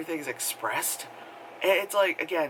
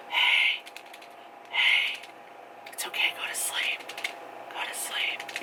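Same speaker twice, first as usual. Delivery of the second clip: ASMR whisper. Retold or imitated. ASMR girl whispering her listener to sleep. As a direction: whisper like an ASMR video lulling you.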